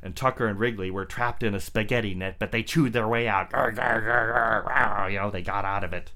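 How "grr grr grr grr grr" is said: quick repeated chewing growls; short gnawing dog snarls. Acted. Dogs chewing out of net.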